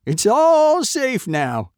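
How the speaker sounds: loud, slow, high all-clear; farmer calling out. A narrator's reading. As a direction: call the all-clear, drawn out. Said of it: "he called".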